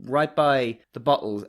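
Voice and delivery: ordinary delivery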